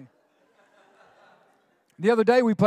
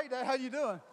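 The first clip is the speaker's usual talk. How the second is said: hushed innocent greeting; 15 dB quieter, faster than usual. Retold or imitated. Kids caught, greeting dad innocently.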